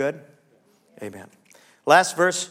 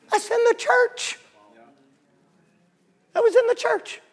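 incredulous. Acted.

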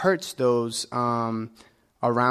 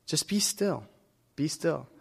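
softer, hushed urging; gentle calm; 3 semitones higher, 5 dB quieter than usual. Acted. Pastor quietly urging the congregation to be still, soft. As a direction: settle them down, gently.

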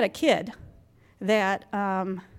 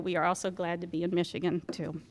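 a much softer, whispered afterthought; whispered aside; 5 semitones lower, 8 dB quieter than usual. Real, not acted. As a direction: tack it on under your breath. Whispered aside after being glad.

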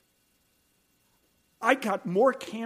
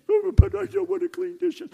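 put-on much higher, louder childish whine; whiny granddaughter; 13 semitones higher, 8 dB louder than usual. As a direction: whine like a sulky little girl.

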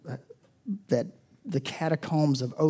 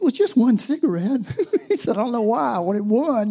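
sheepish confession, voiced high; 7 semitones higher, 4 dB louder than usual.

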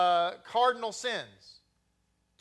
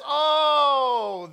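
shouted oh; 5 semitones higher, 8 dB louder than usual. Retold or imitated. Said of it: "then it was like".

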